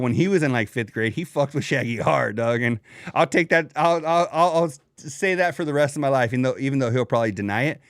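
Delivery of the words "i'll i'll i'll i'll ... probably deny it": usual speaking voice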